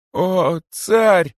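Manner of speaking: high, loud plea; imploring. A narrator's reading. Kosoy appeals to the tsar, imploring him. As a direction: cry out beseechingly, pitched high. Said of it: "взмолился Косой".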